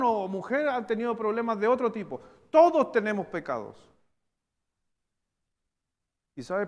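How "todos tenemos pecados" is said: forceful, louder declaration from the pulpit; loud proclamation. Acted. Preacher to congregation, booming loud proclamation. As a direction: proclaim Todos tenemos pecados to the whole room. Preacher repeats point, then softly.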